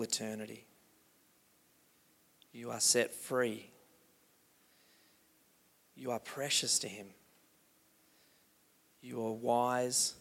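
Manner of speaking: soft, low, slow meditation voice; slow meditative reading. Acted. Pastor reading God's words over the congregation, slow and soft. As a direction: let each line soak in slowly. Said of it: "I'm gonna read some words slowly to you".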